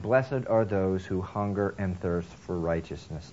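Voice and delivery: slow, deliberate scripture reading; correct quote. Shown as a demonstration. Preacher slowly giving Jesus's actual words. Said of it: "He said".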